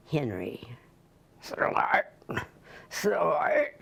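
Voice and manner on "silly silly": breathy whispered "silly"; whispered bulldog voice. Acted. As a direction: whisper the word like a snort. Henry's reply in the poem.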